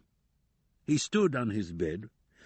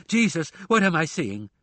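frightened scream; 6 semitones higher, 5 dB louder than usual. A narrator's reading. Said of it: "she screamed".

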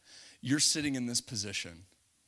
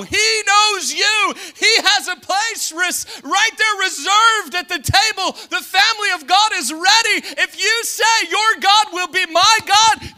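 loud, high preacher's shout; preaching at full force; 16 dB louder than usual. Acted. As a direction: drive the promise home with full voice.

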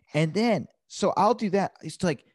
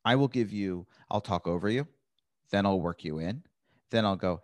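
ordinary delivery

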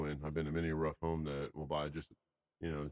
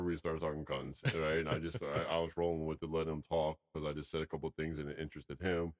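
plain reading voice

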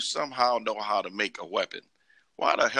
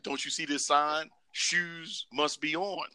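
Gandalf voice; 7 semitones higher, 4 dB quieter, slower than usual. Retold or imitated. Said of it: "that's what Gandalf should have said".

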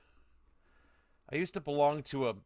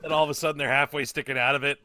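usual speaking voice

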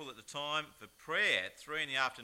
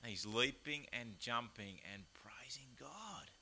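whispered; 3 semitones lower, 7 dB quieter, slower than usual. Acted. Echoing the song line.

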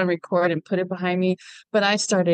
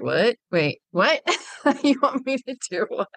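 incredulous rising what with a laugh; retold disbelief; 5 semitones higher than usual. She hears the plan to swim in, with incredulous laughing disbelief. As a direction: play up your own shock, half-laughing. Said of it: "And I was like".

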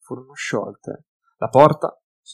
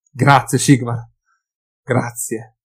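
joyful cry; 6 dB louder, slower than usual. A narrator's reading. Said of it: "gridò, incapace di contenere la gioia".

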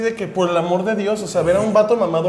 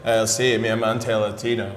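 lower, slowed mock gringo voice; 8 semitones lower, 4 dB quieter, slower than usual. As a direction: drop low and slow like a smitten gringo. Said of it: "los gringos llegan y es como de". (imitación burlona de gringo, más grave y lenta)

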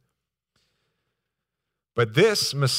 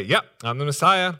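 higher, louder, offhand yep; casual confirmation; 4 semitones higher, 6 dB louder than usual. Acted. Preacher imagining Jesus answering John outright, casual. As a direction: confirm it breezily, as no big deal. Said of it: "doesn't just come out and say".

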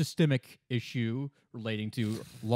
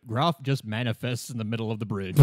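ordinary talk

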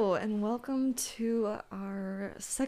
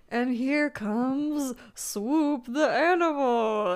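booming, drawn-out announcer call; arena announcer; 5 semitones higher, 5 dB louder, much slower than usual. Acted. Podcaster voicing the announcer in an imagined scenario, arena announcer. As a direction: announce the rider with slow, raised, loud flourish. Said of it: "an announcer say".

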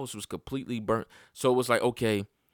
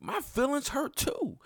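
much higher pitched heated yell; retold yelling. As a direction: cry out how hurt you are. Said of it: "I'm yelling at my shorty".

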